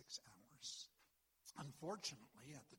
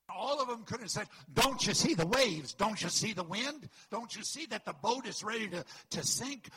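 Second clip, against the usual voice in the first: much louder and higher, disciples yelling at Jesus; desperate shout over the storm; 7 semitones higher, 17 dB louder than usual. Acted. Frightened disciples shouting at sleeping Jesus, loud and high. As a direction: hurl the questions over the wind.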